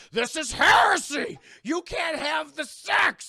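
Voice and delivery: high, loud, slow furious yelling; outraged shout. Retold or imitated. Zealot outraged at a natural birth, high slow furious shout. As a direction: bellow it like a scandalized zealot. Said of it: "Jor-El's like".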